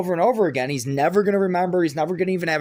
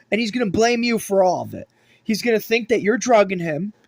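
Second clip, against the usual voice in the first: ordinary talk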